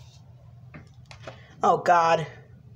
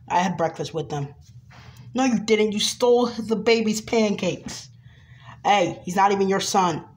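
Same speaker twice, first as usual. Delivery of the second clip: ordinary talk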